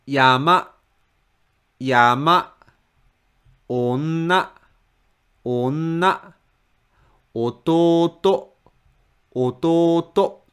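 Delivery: "Yama", "Onna", "Ototo" each stepping up to the last mora; rising pitch. Shown as a demonstration. Teacher shows last-mora stress, "Yama", "Onna", "Ototo" rising. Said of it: "then pitch just rises".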